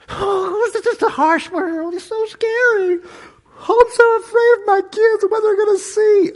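high, fluttery mock-fearful parent; hammed-up timid parent voice; mock scared parent. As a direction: mimic a frightened parent, whining high.

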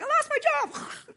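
high, loud hammed-up cry of despair; a comic falsetto moan; mock wail. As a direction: squeal it like an overdone tantrum. Mock of modern people's distress.

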